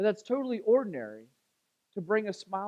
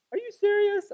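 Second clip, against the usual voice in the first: high, delighted disbelief; surprised; 4 dB louder than usual. Retold or imitated. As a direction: squeal it like a happy surprise. Mom receives surprise gift.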